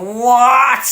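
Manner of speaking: high, loud "what" of disbelief; astonished shout. Retold or imitated. Shopper spotting the price drop, astonished shout. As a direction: yelp it in mock amazement. Acts out spotting bargain Weetabix.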